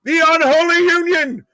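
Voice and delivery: high, loud victory yell; triumphant shout. Real, not acted. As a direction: crow it out like a winning fan. Celebrating a Scottish win live.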